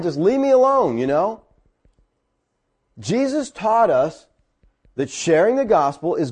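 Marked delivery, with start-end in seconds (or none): 0.0-1.0 mildly acted irritation, pitched high; mad. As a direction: brush them off, mock annoyed. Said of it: "Maybe they get mad".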